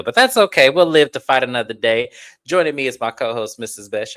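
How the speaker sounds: ordinary talk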